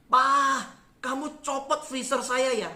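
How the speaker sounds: mock dismay, loud opening word, then slowing; imitated shocked wife. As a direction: mimic a startled wife calling out to her husband. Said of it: "dia kaget".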